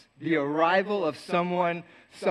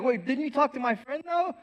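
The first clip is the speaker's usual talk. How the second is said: rising higher in baffled disbelief; confused; 7 semitones higher, 4 dB quieter than usual. Retold or imitated. Asking Lucy about the friend, bewildered. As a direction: pitch climbing on "though" in confusion. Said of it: "I was so confused".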